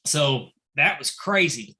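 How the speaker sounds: ordinary talk, nothing marked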